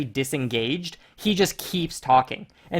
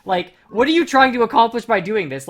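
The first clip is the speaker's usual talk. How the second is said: much higher, louder furious protest; exasperated shout; 7 semitones higher, 9 dB louder than usual. Retold or imitated. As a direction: shout him down, fed up. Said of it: "It's like".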